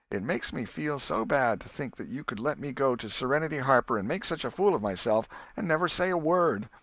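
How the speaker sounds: put-on an old aunt hurt and scolding. Aunt Polly scolding Tom for his lie, hurt and stern.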